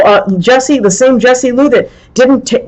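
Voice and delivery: ordinary talk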